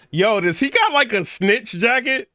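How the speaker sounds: high, incredulous question; can't believe what you're seeing; amused disbelief. Real, not acted. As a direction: pitch shoots up in astonishment. Reacts stunned to the jacket.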